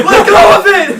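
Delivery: high, loud urgent command; acted yell. Storyteller voicing guys telling him to release tire, acted yell. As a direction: imitate a frantic rescuer yelling. Acting rescuers yelling at him.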